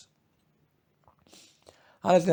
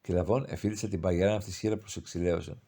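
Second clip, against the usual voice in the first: ordinary delivery, nothing marked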